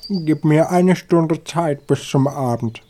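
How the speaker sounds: high-pitched begging; pleading. A narrator's reading. Frightened hawk asking the eagle for time, pleading. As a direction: plead for a reprieve. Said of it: "bat er".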